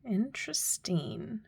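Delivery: whispered with a curious rise. Real, not acted. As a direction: breathe it out, intrigued. Whispering over the tarot cards.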